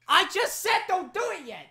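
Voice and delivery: high, loud outburst of exasperation; angry shout. Real, not acted. Host to companion who acted too soon, angry shout. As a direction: yell it, fed up. Snapping at the person hitting him.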